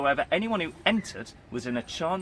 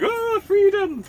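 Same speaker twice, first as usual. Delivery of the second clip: much higher, slow, squeaky panicked cry; fleeing cultist; 7 dB louder, slower than usual. Retold or imitated. Freedom cultists fleeing the dog, high panicked squeal. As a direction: stretch it out in a silly terrified squeak. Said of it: "they'd run away going".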